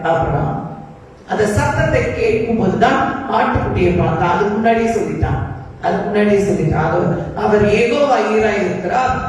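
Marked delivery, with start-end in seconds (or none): none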